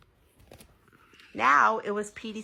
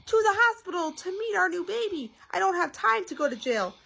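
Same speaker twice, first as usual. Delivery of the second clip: very high, frantic explanation; high, excited insistence; urgent protest; 12 semitones higher, 3 dB louder than usual. A narrator's reading. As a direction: plead in a pitched-up panic. Daddy pleads urgently with policeman.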